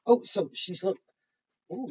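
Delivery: usual speaking voice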